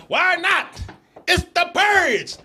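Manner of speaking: high, slow, overexcited sales pitch; hammed-up hawker. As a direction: hawk masks like a manic street seller. Street vendor hawking masks.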